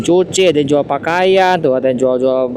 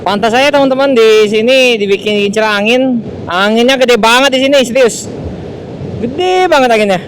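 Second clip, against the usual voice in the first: loud raised voice against the wind; shouting over wind; 7 semitones higher, 4 dB louder than usual. Real, not acted. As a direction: call out over gusting wind. Strong wind at open road. (berteriak melawan angin)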